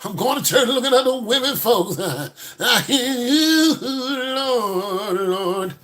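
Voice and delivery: high, slow, pleading cry to the Lord; pleading prayer. Retold or imitated. A churchgoer caught looking at women, praying high and slow. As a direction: beg the Lord for help, half-joking. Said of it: "I like".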